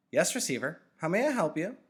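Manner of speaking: high, loud intercom voice; speaker voice. A narrator's reading. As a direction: answer like a polite machine. Speaker voice answers on wall.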